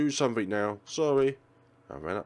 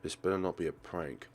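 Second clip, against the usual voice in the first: ordinary talk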